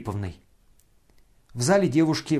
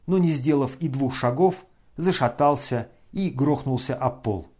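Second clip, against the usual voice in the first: usual speaking voice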